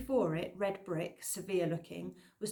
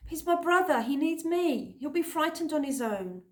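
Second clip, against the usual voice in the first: much higher, louder desperate plea; pleading near tears; 8 semitones higher, 6 dB louder than usual. A narrator's reading. Girl begging to go with her brother, near tears. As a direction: fight to stay with him, welling up.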